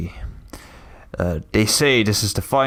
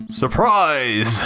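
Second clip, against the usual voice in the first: dramatic entrance; 6 semitones higher, much slower than usual. Acted. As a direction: make a theatrical entrance, slow on "It is I". Grand entrance announced dramatically.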